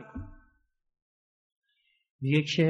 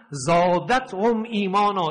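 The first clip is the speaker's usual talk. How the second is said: Quranic recitation; 3 semitones higher, 3 dB louder, slower than usual. Acted. Quran verse recited in sermon.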